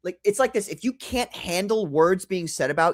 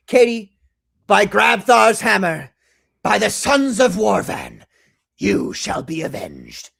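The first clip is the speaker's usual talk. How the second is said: theatrical solemn oath; 6 dB louder, slower than usual. Acted. Host swearing to Katie she shall be avenged, mock-heroic and grand. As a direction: declaim it like a mock-heroic warrior.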